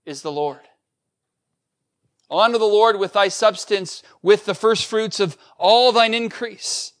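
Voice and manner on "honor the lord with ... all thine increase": louder, higher solemn scripture reading. Acted. Pastor reading Proverbs to the congregation, solemn and louder. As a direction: proclaim the verse to the church. Repeats the verse.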